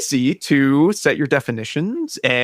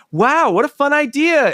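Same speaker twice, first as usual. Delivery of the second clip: high, drawn-out wide-eyed wonder; imitated breezy excitement, pitched well up; mock enthusiasm; 12 semitones higher than usual. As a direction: gush lightly like an easily impressed reader. Voices a casual enthusiast's shallow reaction.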